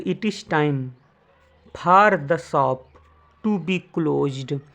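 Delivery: word by word, with gaps; slow dictation. Shown as a demonstration. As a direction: dictate slowly for students to write. Teacher dictates answer slowly. (धीरे-धीरे बोलकर लिखवाना)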